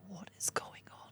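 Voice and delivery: whispered What is going on, hushed and worried; hushed retold dad asking what happened; whispered alarm. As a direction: imitate dad whispering in concern. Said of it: "And he was like".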